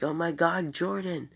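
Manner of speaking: high, alarmed call for his brother; panicked cry. Retold or imitated. Jonathan crying out for his taken brother, high panicked call. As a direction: cry out the name in sudden panic.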